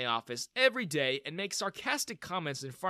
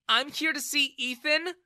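high, louder cold retort; angry reply; 12 semitones higher, 6 dB louder than usual. A narrator's reading. Daughter answers her father, angry and high. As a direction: answer him with contempt. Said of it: "she replied angrily".

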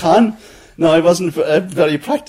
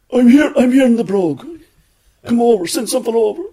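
higher and louder, calling down a phone line; Con on the phone; 10 semitones higher, 3 dB louder than usual. Retold or imitated. Con ringing the office from the Brogue, calling out. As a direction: summon the lad over from the pub. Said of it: "And Con would say".

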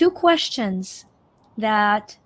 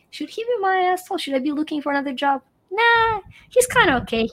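mock musing; 6 semitones higher, faster than usual. Boss screaming, inner thoughts voiced.

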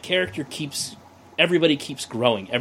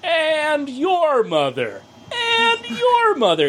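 high, slow, drawn-out mock voice; a sing-song Peralta impression; imitating Peralta; 13 semitones higher, much slower than usual. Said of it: "with Peralta when he's like".

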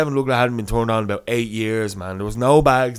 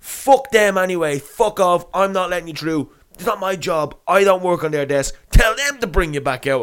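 raised, loud irate security guard; imitated man going mental; 6 semitones higher, 4 dB louder than usual. Security man turns the passenger away, acted angry rant. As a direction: rant like an exasperated guard refusing entry. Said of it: "going fucking mental at me".

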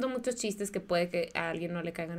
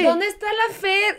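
shouting search; 12 semitones higher, 6 dB louder, slower than usual. Pretend. Imagines finding friend dead.

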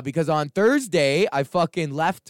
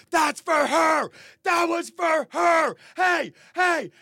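put-on shrill, much higher indignant yell, a whiny boyfriend; high shouting protest; 3 dB louder, slower than usual. Skinny metal guy yelling at the guard, high indignant shout.

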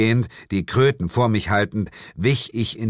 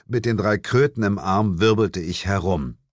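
ordinary delivery, nothing marked

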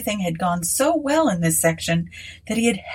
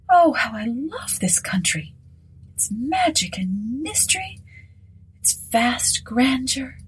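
higher, breathy whispered rapture, slowing; rapturous; 6 semitones higher than usual. Acted. Mrs Sinclair praising Africa, hushed rapture. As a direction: swoon over the land.

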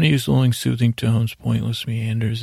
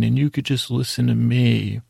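usual speaking voice

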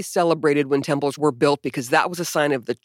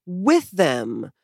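mock pious quote; 3 semitones higher, slower than usual. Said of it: "I weep for them".

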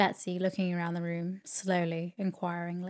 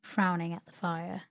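ordinary delivery

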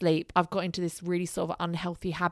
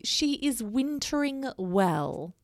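slow, pleased, higher and smug; smugly; 5 semitones higher, slower than usual. Real, not acted. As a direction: gloat a little over it. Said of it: "I've gotta say this rather smugly".

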